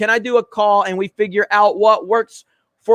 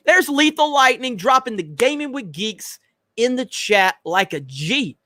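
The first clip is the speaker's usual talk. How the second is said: usual speaking voice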